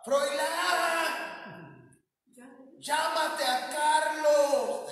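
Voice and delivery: high, drawn-out holler to someone far off; imitated grandma yelling for a relative, high and sustained; grandmother's calling shout. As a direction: holler the names, stretching the last syllable. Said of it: "Y le gritaba".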